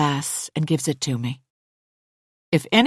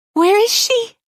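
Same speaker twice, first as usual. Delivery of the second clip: high, loud frightened question; fearful; frightened Hannah; 6 dB louder than usual. Acted.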